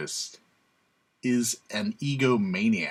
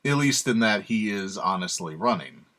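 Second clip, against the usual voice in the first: ordinary talk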